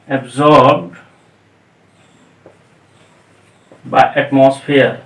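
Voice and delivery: slow word by word, long pauses; dictation pace. Real, not acted. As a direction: say each word slowly as you write it. लिखते हुए शब्द बोलना. (लिखते हुए, धीरे-धीरे)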